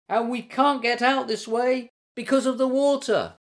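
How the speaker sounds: high, sustained calling voice. A narrator's reading. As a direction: call worriedly across the stream. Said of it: "called Stripey".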